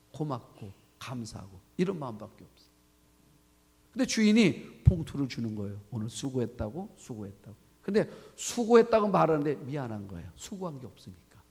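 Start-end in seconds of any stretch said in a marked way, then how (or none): 5.9-7.6 low, soft murmur of a retold employer; understated thanks. Master handing out pay envelopes, low understated thanks. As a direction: drop low and quiet, fading on the repeat.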